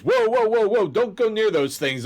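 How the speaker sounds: high, urgent stop-them warning; acted alarmed warning. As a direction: play a jumpy searcher halting a companion. Searcher warns companion off armor.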